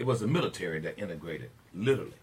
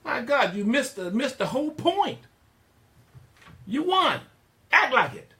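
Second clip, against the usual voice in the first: much higher and louder, hammering each command; exasperated scolding; 8 dB louder than usual. Real, not acted. Host urging listeners to stand tall, loud and scolding. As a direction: bark it like a drill sergeant fed up.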